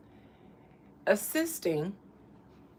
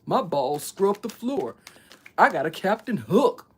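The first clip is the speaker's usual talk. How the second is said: Cali voice; 4 dB louder than usual. Pretend. Streamer slipping into a lazy mock Cali accent. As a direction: lean into a laid-back California voice. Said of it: "Why am I talking in a Cali voice?".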